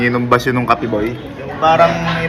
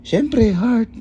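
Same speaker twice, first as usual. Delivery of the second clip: normal talking voice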